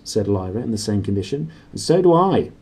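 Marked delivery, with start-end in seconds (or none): 1.7-2.5 higher, louder, stubbornly insisting; a child's stubborn, louder retort. A narrator's reading. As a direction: dig in and insist you like it too. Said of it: "she added stubbornly".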